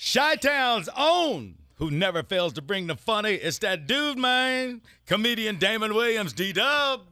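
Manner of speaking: pumped-up radio introduction; hype announcer intro. Acted. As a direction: announce him like a boxer.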